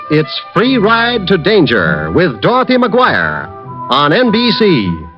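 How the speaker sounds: normal talking voice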